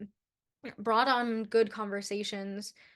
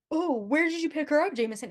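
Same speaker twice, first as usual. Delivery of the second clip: mildly mocking, higher-pitched quote; jerky teasing; 5 semitones higher than usual. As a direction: lightly needle him like a jerk. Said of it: "he's kind of being, like, an ass".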